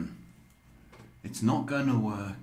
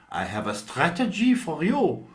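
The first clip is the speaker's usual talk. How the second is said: playful mock German accent, higher and louder; 7 semitones higher, 5 dB louder than usual. Psychic voicing a friend's advice in a mock German accent. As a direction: toss off a quick German-accented line. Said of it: "I'm thinking of a German accent".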